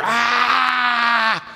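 mock the nursery kid's panic; wailing toddler. Toddler left in the nursery, mock wailing in a high voice. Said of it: "you put 'em back there and they're".

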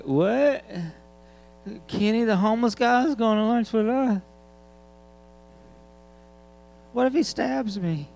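put-on high, whiny kid voice, shocked; alarmed kid. Kids panic at inviting the homeless guy to lunch, high and shrill. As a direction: squeal it like a scared child. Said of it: "their kids are like".

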